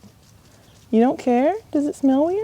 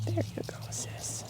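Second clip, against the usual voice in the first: hushed, gentle calming; soft whisper; 10 semitones higher, 15 dB quieter than usual. Real, not acted. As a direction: whisper soothingly to the horse. Soothing a horse while grooming.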